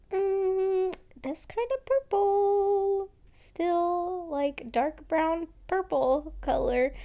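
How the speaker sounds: high, slow, singsong musing. Retold or imitated. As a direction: voice the doubt up high, drawn out. Quoting own doubtful reaction.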